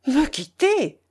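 high-pitched, incredulous question; startled exclamation. A narrator's reading. Old curé learning his friend will leave, startled exclamation. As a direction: cry it out in disbelief. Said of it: "s'écria monsieur Gauss".

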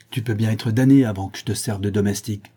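ordinary delivery, nothing marked

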